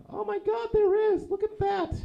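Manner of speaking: very high, loud squeal of delight; thrilled child. Retold or imitated. Niece spotting her pink zebra background, squealing with excitement. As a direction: shriek it like an overjoyed little kid.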